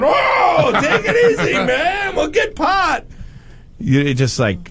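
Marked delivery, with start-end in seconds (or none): 0.0-3.0 high laughing shouts; mock pleading. Teller calming the panicking friend, high laughing shouts. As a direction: yell it, half laughing.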